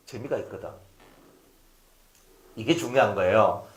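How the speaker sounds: normal talking voice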